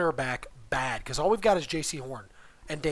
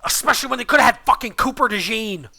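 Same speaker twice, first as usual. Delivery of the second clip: loud, raised-pitch frustrated outburst; exasperated rant; 6 semitones higher, 8 dB louder than usual. Real, not acted. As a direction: vent it loudly, like an aggrieved fan. Fan rants about missed pick.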